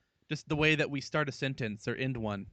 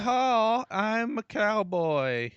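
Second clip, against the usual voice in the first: very drawn-out cowboy Southern drawl; Southern accent; 4 semitones higher, much slower than usual. Shown as a demonstration. Co-host competing in a Southern-off, exaggerated Southern cowboy accent. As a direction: ham up a Southern cowboy yeehaw. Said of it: "Do your best Southern accent".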